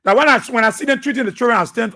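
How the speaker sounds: ordinary talk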